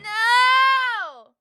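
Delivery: high, loud protesting no; loud shout. Real, not acted. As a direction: yell it in protest. Reacting to TTS joke.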